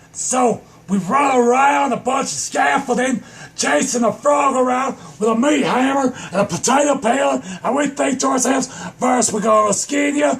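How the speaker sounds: high-pitched, breakneck shouted storytelling; worked-up comic tirade; manic rant. Acted. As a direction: holler it as a frenzied chase. Frantic account of chasing a frog.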